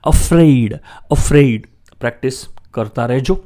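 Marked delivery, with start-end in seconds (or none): 0.0-1.7 અફ્રેઇડ with a firm stressed freid; teacher modelling અફ્રેઇડ; afraid drill. Shown as a demonstration. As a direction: say અફ્રેઇડ loud and clear for repeating. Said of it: "ઉચ્ચાર છે અફ્રેઇડ".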